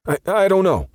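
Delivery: very high, loud panicked stammer; terrified stutter. A narrator's reading. Perp shaking at the mention of Crow, panicked. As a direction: stutter it out in sheer terror.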